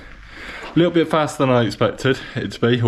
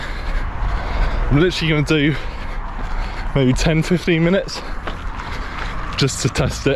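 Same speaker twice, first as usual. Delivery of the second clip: out of breath, talking mid-run; puffing between phrases while jogging; while running; 4 semitones higher than usual. Real, not acted. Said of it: "I'm not used to talking while running".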